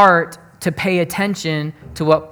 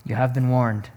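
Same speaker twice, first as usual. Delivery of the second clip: low, softer, grave caution; solemn warning; 5 semitones lower, 4 dB quieter than usual. Real, not acted. Preacher cautions his church about fortune tellers, low solemn warning. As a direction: drop the voice for a final warning. Closing warning after a long pause.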